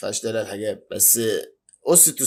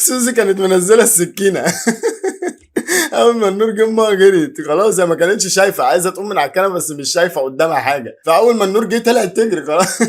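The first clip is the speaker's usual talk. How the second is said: laughing hard; 5 semitones higher, 6 dB louder than usual. Real, not acted. Howling at the mother's dash.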